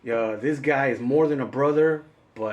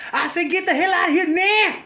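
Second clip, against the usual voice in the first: very high, louder cranky shout; mock furious yell; 4 dB louder than usual. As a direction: scream it out like a grumpy old man. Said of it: "He's like".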